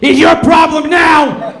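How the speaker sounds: slow, high, loud mock shout; the mother yelling back at the father, hammed-up; mom shouting. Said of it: "And my mom goes".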